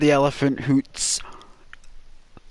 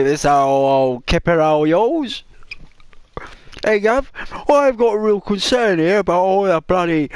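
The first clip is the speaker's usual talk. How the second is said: raised, hammed-up Cockney voice; 5 semitones higher than usual. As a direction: ham up a London Cockney complaint. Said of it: "they even wrote it in a Cockney rhyme".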